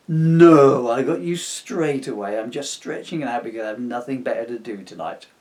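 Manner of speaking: loud, dry sarcastic reply; deadpan the mockery at the ghost. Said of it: "Spoiler alert, sarcasm".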